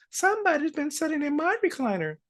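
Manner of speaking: high-pitched mother bear voice; Mama Bear. Acted. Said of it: "Mama Bear says".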